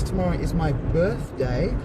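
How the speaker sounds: ordinary delivery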